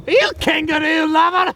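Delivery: high, outraged name-calling; comic insult. Acted. Nature host insulting his rival, outraged. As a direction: fling a silly insult at your rival. Trading silly insults in quarrel.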